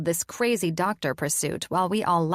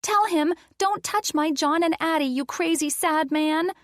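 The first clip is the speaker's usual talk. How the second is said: put-on high, excited child's voice; little girl; 10 semitones higher, 3 dB louder than usual. Addison whispering orders to Lena, excited. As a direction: giggle it like an eager little girl.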